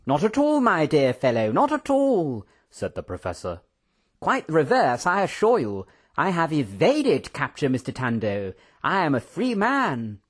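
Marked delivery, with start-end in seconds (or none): 0.1-2.5 high, swooping protest; excitable professor. Acted. Professor denying he was caught, excitable. 4.2-10.2 proud, swooping high lilt; excitable professor. Acted. Professor announcing his freedom, excitable and proud. Professor boasts of evading capture.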